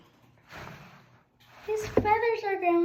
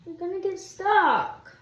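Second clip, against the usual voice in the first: higher, slow, louder exasperation; exasperated; 4 semitones higher, 7 dB louder, slower than usual. Real, not acted. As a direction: plead with him, drawn out. Bird stuck in shirt.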